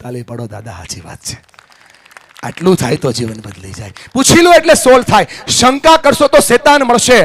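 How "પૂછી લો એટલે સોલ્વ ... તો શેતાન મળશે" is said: high, loud motivational oratory; full-force preaching. Real, not acted. Speaker warning families about assuming, full-force preaching. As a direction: drive the point out to the whole hall.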